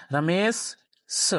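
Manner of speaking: रमेश स with a drawn-out hissing s; slow hissing demo of रमेश स; hissing double s. Shown as a demonstration. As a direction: stretch रमेश स slowly, hissing the s twice. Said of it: "दो बार सा सा की sound निकली".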